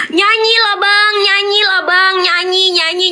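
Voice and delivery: high, chanting mock urging; crowd calling out. Crowd urging Aisar to sing, high chanting call. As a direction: egg him on like a pestering crowd. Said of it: "terus kan ada yang nyeletuk".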